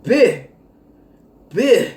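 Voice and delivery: loud drawn-out rapper "Bih"; [name] voice. Retold or imitated. Vlogger mocking the coworker in [name]'s voice. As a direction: bark it like [name]. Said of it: "in my [name] voice".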